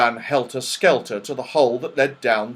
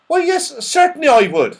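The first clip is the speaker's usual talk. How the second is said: put-on eager high old man; saucepan man; 7 dB louder than usual. Saucepan man accepting tea with his friend, eager.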